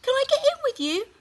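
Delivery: put-on very high, loud toddler plea; little one pestering mum; tiny child. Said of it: "asked the little one".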